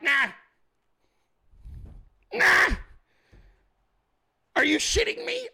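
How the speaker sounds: high-pitched disbelieving protest; incredulous outburst. Acted. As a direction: squawk it in exasperated disbelief. Mock refusal and disbelief at wife.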